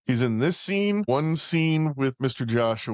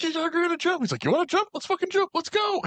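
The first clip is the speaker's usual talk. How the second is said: squeaky high cartoonish goading; manic mock voice. Cop daring the jumper on the roof, manic high squeal.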